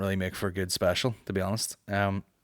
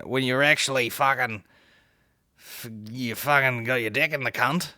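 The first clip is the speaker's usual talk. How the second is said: put-on Australian accent; 3 semitones higher than usual. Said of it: "in an Australian accent".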